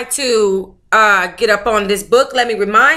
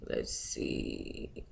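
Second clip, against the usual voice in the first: whisper; 21 dB quieter than usual. Real, not acted. Muttered to self.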